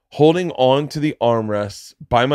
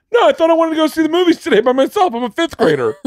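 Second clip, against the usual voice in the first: put-on high, fast, squeaky little-kid voice; hammed-up young boy; mock kid voice; 3 dB louder, faster than usual. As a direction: play the fifth grader talking to himself. Mock kid voice in retold scene.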